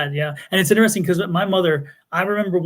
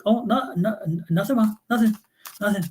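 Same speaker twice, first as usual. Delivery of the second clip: put-on higher, stammering child's brush-off; retold nervous denial; 4 semitones higher than usual. Child hiding trouble from mom, nervous denial. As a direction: play a kid hurriedly covering up.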